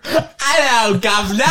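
high imitated British greeting; British accent. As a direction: mimic a British bloke. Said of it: "He sounds so different when Robert takes over".

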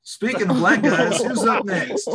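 high, loud joking host voice; hammed-up announcer segue; mock host segue.

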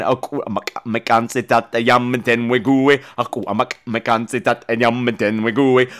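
low, measured South African-language recitation; South African tongue twister. Shown as a demonstration. Showing off a South African tongue twister, low and deliberate South African accent. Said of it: "now I can speak South African".

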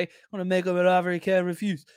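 a hammed-up mob boss voice, only lightly changed; lightly voiced Godfather, half-joking; bad Godfather impression. Host jokes like the Godfather about a trade offer, lightly voiced mob boss. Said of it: "worst Godfather impression of all time".

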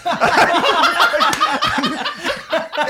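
big burst of high laughter; loud high laugh. Real, not acted. Laughing at the bad documentary, a loud high laugh.

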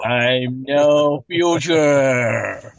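slow deadpan. Pretend.

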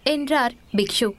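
ordinary delivery, nothing marked

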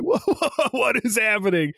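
high-pitched imitated shock; mock astonishment. Retelling their reaction to Peter Parker, high mock astonishment. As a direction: react stunned, as the characters retold.

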